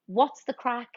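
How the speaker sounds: usual speaking voice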